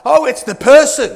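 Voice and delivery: loud, dismissive "Oh, it's the person"; mock self-excuse. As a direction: brush off the blame. Self-convincing after bad hire.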